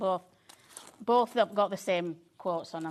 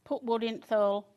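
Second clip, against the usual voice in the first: Yorkshire accent; 3 semitones higher, 7 dB louder than usual. Shown as a demonstration. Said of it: "say it in your Yorkshire accent".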